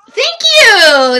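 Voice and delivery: loud, high thank you; excited. Real, not acted. Greeting the cat as she hops up, excited and loud. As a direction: cheer the cat's arrival. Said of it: "greeting the cat loudly".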